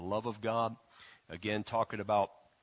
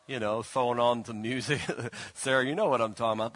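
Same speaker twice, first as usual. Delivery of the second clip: normal talking voice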